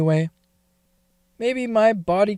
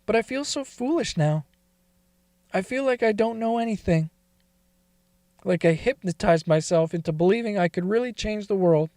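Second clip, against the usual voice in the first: ordinary talk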